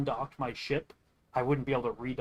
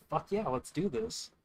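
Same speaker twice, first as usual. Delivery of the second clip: a high, eager burst of enthusiasm; excited yes; 5 semitones higher than usual. Retold or imitated. Telling his buddies yes to the moon mining, excited. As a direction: jump at the offer, pumped.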